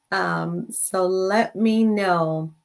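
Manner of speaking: ordinary talk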